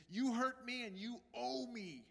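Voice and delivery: normal talking voice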